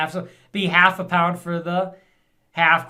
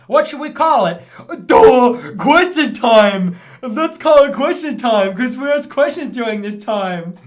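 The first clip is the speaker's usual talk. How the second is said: mocking dim official; 7 semitones higher, 10 dB louder than usual. Streamer mocking parliament as dim, high and loud. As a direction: play a smug idiot naming it. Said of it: "It's like".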